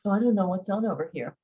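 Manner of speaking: ordinary delivery, nothing marked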